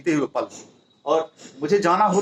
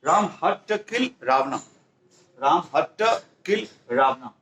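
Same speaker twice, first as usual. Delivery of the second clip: very slow, each word spaced; slow model sentence; much slower than usual. Shown as a demonstration. Teacher dictating Ram's sentence to students, very slowly.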